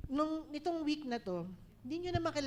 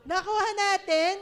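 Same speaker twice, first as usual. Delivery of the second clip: loud laughing call-out; 7 semitones higher, 12 dB louder than usual. Real, not acted. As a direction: shout it with a laugh, high and loud. Preacher calls out to congregation. (malakas na sigaw na may tawa)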